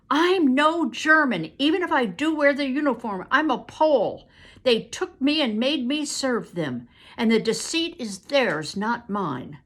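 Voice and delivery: loud, high pleading protest; desperate plea. Acted. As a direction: insist you are no German. Captive pleads his innocence.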